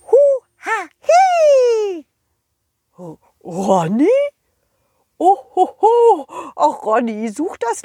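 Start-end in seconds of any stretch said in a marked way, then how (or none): 0.0-2.0 high, slow squeaky worm call; worm noise. A narrator's reading. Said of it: "der schaute mich komisch an und machte". 5.2-6.3 Santa laugh. Acted.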